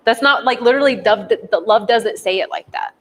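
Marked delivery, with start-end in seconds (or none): none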